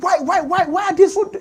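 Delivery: high-pitched retold exasperation; exasperated parent. Parent upstairs fed up with the children, acted exasperation. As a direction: fire off the whys as a fed-up parent. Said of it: "I'm, I'm angry".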